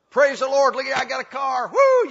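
loud, high praise shout building to a whoop; joyful whoop. Real, not acted. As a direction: whoop it up like the Spirit hit you. Preacher whoops with joy.

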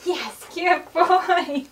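stretched-out cheer for the dog; loud dog praise. Real, not acted. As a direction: reward him with a big beaming voice. Praising dog after a good wave.

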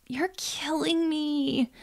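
slow breathy whisper of defeat. A narrator's reading. Friend whispering her despair that Arnessa won't come. Callie groans in frustration.

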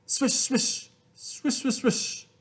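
soft breathy swishes, rising in pitch; whispered swish. A narrator's reading. Reading to kids, soft rolling sound. Sound effects in read-aloud story.

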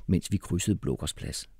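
plain reading voice

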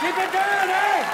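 very high, loud shout; Canadian neighbour yelling. Acted. Canadians in the loft above the party complaining, shouted. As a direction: holler down at a noisy party. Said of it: "They're like".

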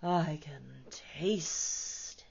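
slow, whispered sigh. Acted. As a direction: savour it on a breath. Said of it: "he sighed".

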